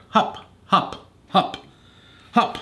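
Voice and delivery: high, punchy ringmaster calls; shouted command. A narrator's reading. Arthur the ringmaster drilling chickens, shouting. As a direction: bark out each hup separately. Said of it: "and Arthur shouted".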